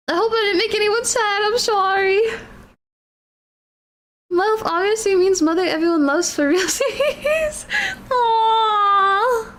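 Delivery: high, teary, touched voice; tearful, moved. Real, not acted. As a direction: speak through happy tears. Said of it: "I feel bad".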